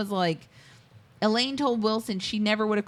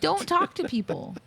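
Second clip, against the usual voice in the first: higher-pitched, lightly voiced mom warning; a hint of mom, pitched up; mom's warning; 7 semitones higher than usual. Co-hosts joking about a mom's advice, lightly imitated high mom warning. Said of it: "Like your mom does".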